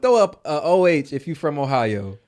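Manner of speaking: loud, high call to the chat; rally the Ohio viewers loudly. Real, not acted. Host calling Ohio viewers in the chat, loud rally.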